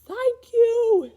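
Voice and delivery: high dog thanks; grateful dog. Acted. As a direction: bark a happy thank you. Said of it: "said the bo-, the dog".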